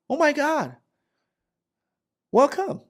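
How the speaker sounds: over-friendly high-pitched welcome; mock chatterbox greeting a newcomer; high-pitched chatty Kiana. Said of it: "she's always very high pitch".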